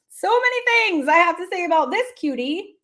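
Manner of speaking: usual speaking voice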